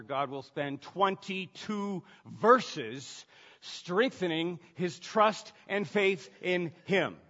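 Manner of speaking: slow, deliberate preacher's build; emphatic preaching. Real, not acted. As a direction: drive each word home, loud and slow. Preaches with force.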